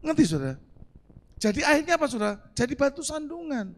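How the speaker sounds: ordinary talk, nothing marked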